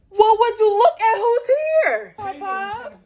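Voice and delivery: high, slow mock welcome; loud, sing-song who's here; sarcastic greeting. Mom arrives, mock greeting.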